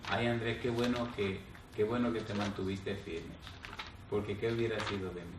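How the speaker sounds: quiet and moved, through tears; dying mother, tearful. Retold or imitated. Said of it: "con una sonrisa y con lágrimas en los ojos". (voz suave y quebrada de madre moribunda)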